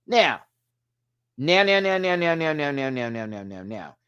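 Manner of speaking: rapid repeated word sinking away like a winding-down siren; descending stutter. Real, not acted. Reviewer moving on to critiques, comic descending stutter.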